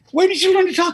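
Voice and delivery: high, loud acted amazement; astonished. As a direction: quiz the talking dog in hammed-up disbelief. Joke's man astonished by talking dog.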